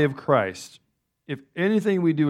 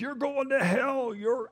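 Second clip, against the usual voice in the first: mild mock bullhorn preacher; 12 semitones higher, 5 dB quieter, slower than usual. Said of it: "somebody has a bullhorn".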